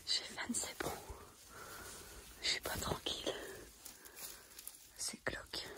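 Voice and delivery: hushed whisper, barely voiced; library whisper. Real, not acted. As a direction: whisper so nobody hears you. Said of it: "Je parle tout bas". (chuchotement discret)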